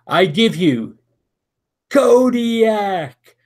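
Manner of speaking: booming ring announcer call; announcer voice filling the arena. Acted. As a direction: roll out the winner like a ring announcer. Announcer reads the match result.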